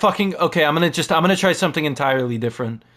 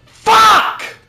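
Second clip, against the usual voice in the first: high, loud angry yell; furious shout; 8 dB louder than usual. Real, not acted. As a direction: scream it in frustration. Gamer raging at death.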